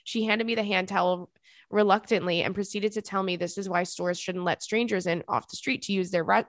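usual speaking voice

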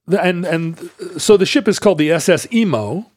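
usual speaking voice

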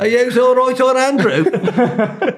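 put-on high, laughing Brummie accent. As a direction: ham up the Brummie accent, drawn out. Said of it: "That's Brummie, you're going Brummie".

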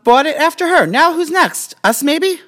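squeaky, rising mock excitement; high excited voice. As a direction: pitch way up, playful.

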